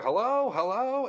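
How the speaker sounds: high, drawn-out mock yell into a phone; retold screaming. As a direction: imitate someone calling down a dead line, upset. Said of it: "and screaming into the phone".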